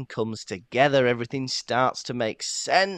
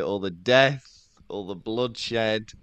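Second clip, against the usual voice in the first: slow dramatic emphasis; 2 semitones lower, slower than usual. Real, not acted. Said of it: "dramatic slowed emphasis".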